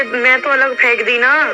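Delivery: ordinary delivery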